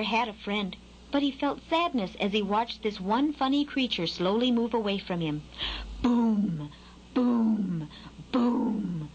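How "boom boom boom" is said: drawn-out falling boom; booming noise. A narrator's reading. Huge animal's noise vibrating through Skylark, resonant booming. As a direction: imitate a heavy thud that resonates. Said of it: "The noise vibrated through Skylark".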